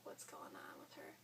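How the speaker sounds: whispering behind her back; hushed murmur, barely voiced; worried whisper. Mom whispering to someone about her daughter, hushed.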